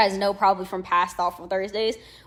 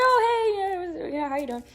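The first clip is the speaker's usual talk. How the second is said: surprised greeting; 10 semitones higher than usual. Retold or imitated. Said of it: "He was like".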